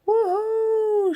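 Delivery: high, loud woohoo; excited whoop. Acted. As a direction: whoop as you fly up. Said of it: "she whooped".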